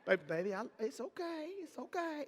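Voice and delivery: soft, reassuring murmur; calm him down gently; soothing. Shown as a demonstration. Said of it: "don't be like".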